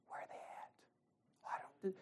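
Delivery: whispered churchgoer asking; slow gossiping whisper; mock whisper. People wondering about absentee.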